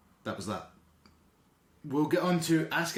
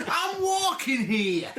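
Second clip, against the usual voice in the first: high, slow New York cry; hammed-up high New York accent; 13 semitones higher, 4 dB louder, much slower than usual. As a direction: play the classic angry New Yorker.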